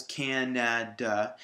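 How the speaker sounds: slow "Canada", syllable by syllable; separated syllables. Shown as a demonstration. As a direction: say "Canada" as three separate beats, CAN-AD-UH. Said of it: "separate the three syllables".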